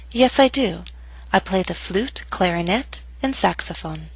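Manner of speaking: "flute" and "clarinet" rise, "saxophone" falls; list intonation. Shown as a demonstration. Teacher models listing items, rising then final falling intonation. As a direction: lift each item, drop on the last, "saxophone". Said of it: "use rising intonation until you get to the last item".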